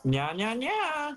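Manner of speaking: high, drawn-out meowing; playful cutesy cat imitation; cartoon cat noises. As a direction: mew it slowly in a rising pitch. Imitating Desmond's cat meme reply.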